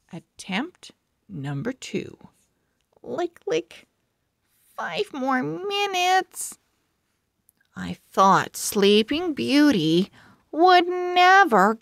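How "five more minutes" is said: high, drowsy grumble; half-asleep plea for more sleep; sleepy girl voice. Acted. Girl refusing to wake up.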